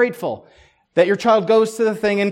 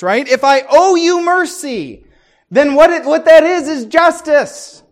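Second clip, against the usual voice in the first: fiery preaching; 7 semitones higher, 10 dB louder than usual. Real, not acted. Preaching at full force.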